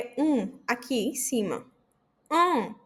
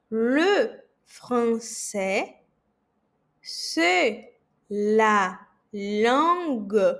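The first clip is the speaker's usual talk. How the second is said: "le français, c'est la langue plus belle du monde" muito devagar; very slow; much slower than usual. Shown as a demonstration. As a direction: arraste cada palavra para o aluno. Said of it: "bem pausadamente". (muito lento e pausado)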